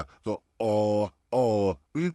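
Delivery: ओ with lips only half rounded; ओ, lips in an oval, not a circle; wrong oval o. Shown as a demonstration. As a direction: demo the faulty oval ओ. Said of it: "इस तरीके से ओ का sound नहीं आएगा".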